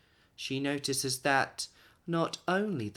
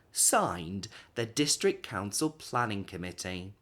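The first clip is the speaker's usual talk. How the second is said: formal letter reading; 4 semitones higher than usual. A narrator's reading. Narrator reading the council letter aloud, formal and official. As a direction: read it like a stiff council notice.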